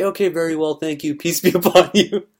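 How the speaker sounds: breaking into laughter through a mock-calm answer; amused, giggling through the kind reply; laughing reply.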